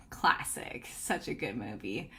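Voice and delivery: hushed aside. Real, not acted. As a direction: lean in and murmur it like a secret. Hushed aside about Twister.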